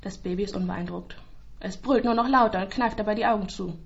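usual speaking voice

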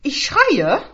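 loud, high threatening cry; sharp, high-pitched outburst; fierce threat. A narrator's reading. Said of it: "aber Rita zischte".

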